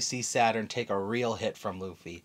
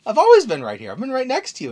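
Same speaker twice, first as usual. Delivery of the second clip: put-on higher, louder cheerful boy; lightly voiced carefree Luffy reassuring her; Luffy telling Bonnie he was always there, cheerfully; 6 semitones higher, 7 dB louder, faster than usual. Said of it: "And Luffy goes".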